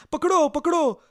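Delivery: high, loud cry of catch him; shouting. Acted. Young woman at the window calling the crowd, shouting. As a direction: yell down from the window. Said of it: "असे ओरडत असते".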